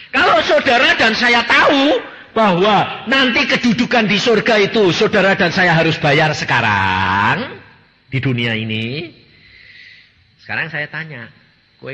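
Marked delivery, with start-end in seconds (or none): 0.1-9.1 high, loud, slow pulpit preaching; preaching at full force. Acted. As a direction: drive the point home to the congregation. Preacher raising voice on heaven.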